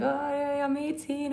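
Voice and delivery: a teasing imitation of the viewer; mocking a viewer. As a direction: lift the pitch to mock him. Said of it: "He's like".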